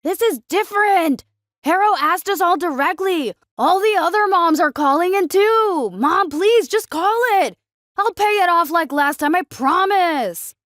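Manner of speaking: high, loud, desperate begging; frantic teenage plea; pleading teen son. A narrator's reading. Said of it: "John pleaded".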